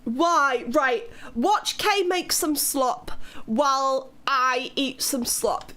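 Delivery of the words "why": loud, drawn-out why; exasperated. Real, not acted. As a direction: cry out in mock despair. Exasperated at chat.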